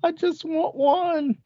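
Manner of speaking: high, slow mock whimpering plea; hammed-up tearful begging; mock crying plea. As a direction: beg like a blubbering fan, way up high and drawn out. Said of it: "where he was crying, kneeling, going".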